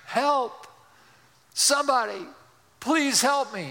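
high-pitched cry for help, not fully screamed; mock scream for help. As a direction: call out for rescue, acting desperate. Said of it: "he begins to scream".

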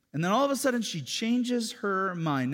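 loud, high, impassioned sermon; full-force preaching. Acted. Pastor telling congregation men and women differ, fervent. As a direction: drive the point home.

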